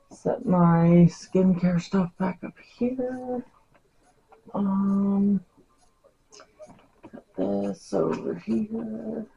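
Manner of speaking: quiet muttering to herself; grumbling to self. Real, not acted. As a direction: mumble under your breath while tidying. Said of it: "Sorry, I'm talking to myself".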